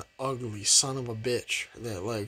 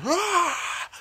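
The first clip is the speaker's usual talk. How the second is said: high yelp; 3 dB louder than usual. Real, not acted. Excited yelp after movie title.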